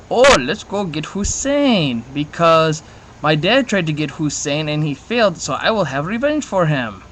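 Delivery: light put-on Middle Eastern accent, slow start; joking Middle Eastern accent impression. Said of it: "kinda funny doing Middle ac-, Middle Eastern accent".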